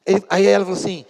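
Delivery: ordinary delivery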